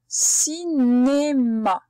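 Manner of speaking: "Cinéma" drawn out very slowly, syllables linked; stretched syllables. Shown as a demonstration. Teacher stretching the word for learners, "Cinéma" in long slow syllables. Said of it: "C'est bien dans la première syllabe".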